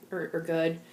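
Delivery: normal talking voice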